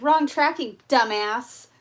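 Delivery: loud, punched insult; retold scornful retort. Buyer correcting the scammer about tracking, loud scorn. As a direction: throw it back loudly at them.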